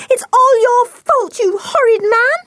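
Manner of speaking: put-on high, loud girlish outburst; little scream. A narrator's reading. Anne blaming the smuggler, screaming. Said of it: "Anne gave a little scream".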